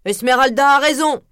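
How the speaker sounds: lightly voiced merchant cheering; approving merchant. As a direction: back her up before the crowd. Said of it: "approuva le marchand ambulant".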